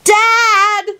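put-on very high child's call; high-pitched drawn-out yell; Teddy, yelling. A narrator's reading. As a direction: call up to another floor like a small boy. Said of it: "Teddy yelled from downstairs".

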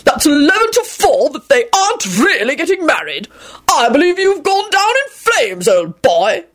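high, put-on crowing voice; triumphant gloating. A narrator's reading. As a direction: crow over the defeated rival. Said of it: "Richard screamed triumphantly at Quentin".

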